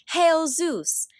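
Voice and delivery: high, loud joyful hail; joyful cheer. A narrator's reading. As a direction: greet the freeing hero with joy. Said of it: "They wept with joy".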